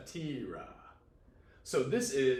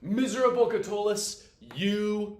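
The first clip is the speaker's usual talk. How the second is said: higher, louder mock-tragic cry; dramatic lament; 7 semitones higher, 7 dB louder than usual. Teacher voices Catullus addressing himself, mock-tragic. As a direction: wail it like a lovelorn poet.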